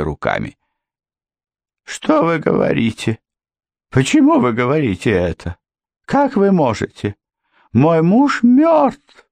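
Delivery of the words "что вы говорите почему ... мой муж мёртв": helpless babbling. A narrator's reading. Housekeeper helplessly protesting that her husband is dead. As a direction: fall apart, helpless and pleading. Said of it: "беспомощно пролепетала она".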